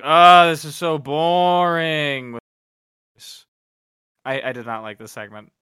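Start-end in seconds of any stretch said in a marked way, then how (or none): 0.0-2.4 slow, loud mock-bored drawl. Watching a dull wrestling segment, drawled boredom. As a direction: drag it out like you're bored stiff. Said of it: "my note is like".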